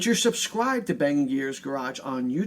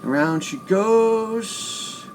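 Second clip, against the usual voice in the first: drawn-out announcer call; 4 semitones higher, much slower than usual. Real, not acted. Spinning the prize wheel.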